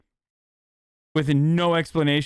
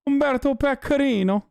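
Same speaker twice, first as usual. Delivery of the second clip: high, playful Italian name flourish; Italian accent; 10 semitones higher than usual. Pretend. Trying out a character name in a high Italian accent.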